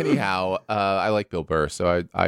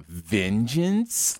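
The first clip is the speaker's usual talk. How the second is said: sarcastic questioning "vengeance". Shown as a demonstration. Explaining their acting to co-host, sarcastic "vengeance". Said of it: "like sarcasm".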